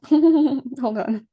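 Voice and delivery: laughing breath out. Real, not acted. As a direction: laugh and exhale. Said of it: "laughing, recovering".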